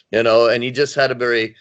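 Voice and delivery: usual speaking voice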